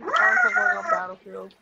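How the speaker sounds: yelled out loud, high; shouting. Real, not acted. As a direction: shout it across the room. Shouting over the game.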